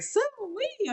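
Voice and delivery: high, soft mock call of Sue Wee; imitating the dad. Reviewer mimicking the dad's weird tagline, high and soft. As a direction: squeak out the dad's odd call. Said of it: "He was like".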